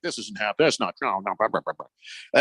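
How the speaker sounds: mimicked brush-off, low and tossed away; mock denial. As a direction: wave it off as imagined deniers, dropping into blahs. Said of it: "people are like".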